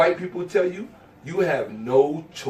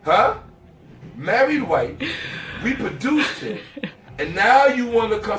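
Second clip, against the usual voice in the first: mocking retort; 3 semitones higher, slower than usual. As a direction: scoff at her through laughter. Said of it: "And I'm like".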